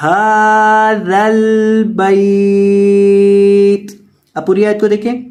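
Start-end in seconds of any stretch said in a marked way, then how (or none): 0.1-3.9 هذا with the ہا drawn out long; long ha in hadha. Shown as a demonstration. Teacher drawing out the ہا for students. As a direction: stretch the ہا of هذا slowly. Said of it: "ہا کو لمبا کرنا ہے".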